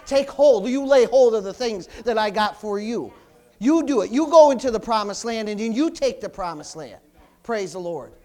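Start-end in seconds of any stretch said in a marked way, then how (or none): 0.1-7.0 loud, driving preaching; full-force preaching. Acted. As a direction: push them to take hold.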